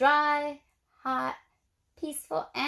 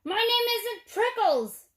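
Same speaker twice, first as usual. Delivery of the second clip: high, louder indignant yell; yelled back; 9 semitones higher, 3 dB louder than usual. A narrator's reading. Cactus yelling back at the jackrabbit, indignant. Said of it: "Hank yelled back".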